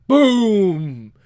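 loud boom effect; explosion sound. Acted. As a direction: make a big boom. Voiced explosion.

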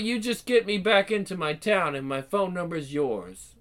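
usual speaking voice

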